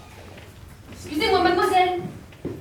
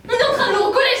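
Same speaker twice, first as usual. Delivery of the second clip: angry outburst; 5 semitones higher, 5 dB louder, faster than usual. Acted. Wife quarrels with the assistant.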